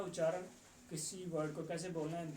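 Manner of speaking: ordinary talk, nothing marked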